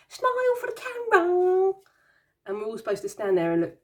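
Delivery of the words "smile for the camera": much higher, louder mock mum voice; mum with the camera. Imitating her mum posing the kids for photos, high mock voice. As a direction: chirp it like a mum taking holiday photos. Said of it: "she's like".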